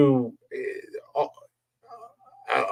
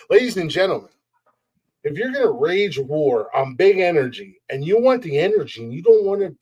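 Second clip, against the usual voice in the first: ordinary talk, nothing marked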